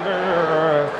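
long, falling flexing roar; straining show-off roar; muscleman's growl. Shown as a demonstration. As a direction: hold the mock bodybuilder growl. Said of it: "like that".

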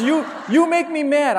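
put-on high, loud laughing child's shout; angry child. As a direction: shout it like a furious little boy. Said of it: "He says".

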